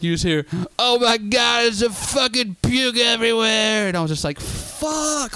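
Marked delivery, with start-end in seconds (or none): 0.8-3.9 high, loud, drawn-out wail; imitated shriek of disgust; mock panicked outcry. Retold outcry from the back seat. 4.4-5.4 high, drawn-out curse; mock high-pitched despair; retold dismay. His dismayed reaction to the puking.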